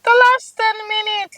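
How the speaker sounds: high, loud excited call of the last ten minutes; excited announcement. Real, not acted. To her viewers, announcing the final ten minutes, excited and loud. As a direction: hype it up like a countdown.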